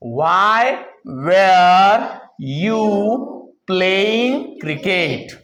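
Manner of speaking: isolated words with clear pauses; very slow, one word at a time; word-by-word modelling. Shown as a demonstration. Teacher forming the past tense question for students, word-by-word modelling.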